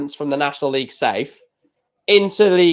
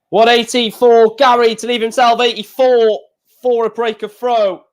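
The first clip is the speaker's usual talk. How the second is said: lively play-by-play, higher and louder; darts commentary call; 6 semitones higher, 5 dB louder than usual. Acted. Fan watching darts, calling the maximum like a commentator.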